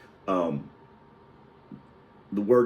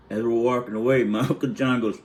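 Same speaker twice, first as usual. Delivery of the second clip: plain reading voice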